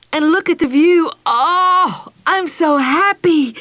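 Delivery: put-on high, loud, overjoyed child; joyful. Meg hugs Mother over the picnic news, joyful. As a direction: let happiness spill over. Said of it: "skipped joyfully".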